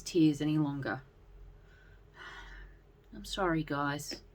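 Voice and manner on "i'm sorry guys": softer, slow, tearful apology; quiet admission. A narrator's reading. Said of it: "Sir Lionheart admitted".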